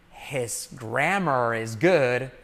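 "His grammar is good" said very slowly and carefully; slow model sentence. Shown as a demonstration. Teacher slowing "His grammar is good" so students can copy. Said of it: "mirad, but his".

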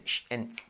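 hushed "sh", then a thinner "s"; sh versus s. Shown as a demonstration. Teacher contrasts two sounds, sh versus s. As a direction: keep "sh" distinct from "s". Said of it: "So, diferenciarlos bien".

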